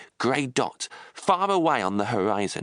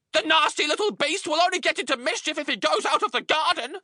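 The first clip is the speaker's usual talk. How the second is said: high, sneering spiteful aunt voice; Aunt Spiker. A narrator's reading. Aunt Spiker forbidding James to leave the garden. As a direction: look down on the boy and sneer. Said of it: "Aunt Spiker had said".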